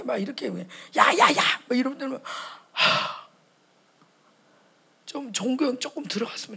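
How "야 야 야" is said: sharp call-out. Retold or imitated. Calling out to stop him.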